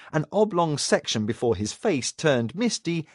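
ordinary delivery, nothing marked

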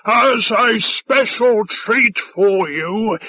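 slow, high, hearty shout; blustering alien host. A narrator's reading. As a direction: boom it grandly at a guest. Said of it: "he shouted".

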